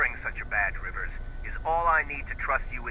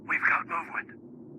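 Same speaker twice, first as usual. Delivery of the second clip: hushed radio report; 5 semitones higher, slower than usual. Acted. Spotter reporting over radio.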